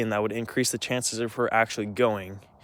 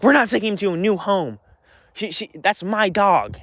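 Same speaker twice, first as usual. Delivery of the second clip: higher, louder mocking voice of Mom; 7 semitones higher, 4 dB louder than usual. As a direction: parody Mom's protest, pitched up and indignant. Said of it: "Mom is like".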